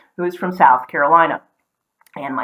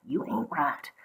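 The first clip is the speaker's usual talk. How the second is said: softer Southern "you ain't right"; Southern accent; 13 dB quieter, slower than usual. Shown as a demonstration. Showing a Southern phrase, softer Southern accent.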